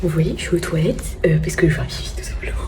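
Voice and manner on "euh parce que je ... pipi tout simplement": hushed toilet whisper; keeping the voice down in a stall. Real, not acted. (chuchoté)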